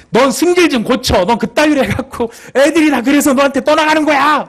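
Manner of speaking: loud, high-pitched acted outburst; angry scolding. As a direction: lash out, building to a shout. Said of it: "자기가 옮길 때는 그렇게 옮겼지만 실제로는". (버럭 화내며 꾸짖듯)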